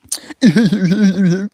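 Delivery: high loud burst of laughter. Real, not acted.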